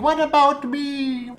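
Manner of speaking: high little plant voice crying for its turn; plants screaming for attention. Pretend. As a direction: voice the neglected plants begging, slow and pleading. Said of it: "They're just screaming".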